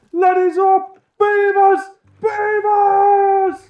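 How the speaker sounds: calling out. Pretend. Gamer stuck underground yelling up at the beavers. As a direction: yell for help from below.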